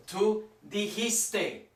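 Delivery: Tú dijiste said very slowly, syllable by syllable; slow it right down on Tú dijiste; slow modelling. Shown as a demonstration. Teacher to class at a tricky form: very slow model. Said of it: "Oh boy, look at that".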